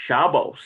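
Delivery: Shabbos, slow, ending in s; Shabbos pronunciation. Shown as a demonstration. Teacher contrasting the Shabbos pronunciation for students. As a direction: say Shabbos with the s sound, stress on Shab. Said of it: "some will say".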